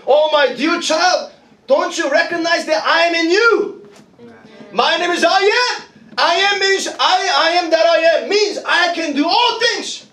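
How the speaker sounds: high-pitched, impassioned divine appeal; crying out. Acted. Christ calling to his dear child, crying out. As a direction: call out from within with rising fervour. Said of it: "he's crying out in you".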